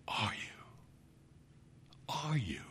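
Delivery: very soft, menacing question; hushed pressing. A narrator's reading. Narrator bent close over Monica, hushed pressing. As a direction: press it softly, right up close.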